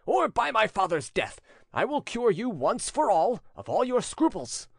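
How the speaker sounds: high-pitched angry oath; furious threat. A narrator's reading. Prince threatening his reluctant servant, furious. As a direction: threaten with rising, heated force. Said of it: "stamping his foot furiously".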